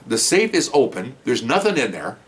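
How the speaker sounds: ordinary talk, nothing marked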